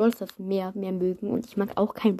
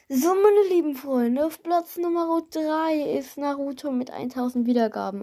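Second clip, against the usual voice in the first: hyped giveaway announcement; 5 semitones higher than usual. Real, not acted.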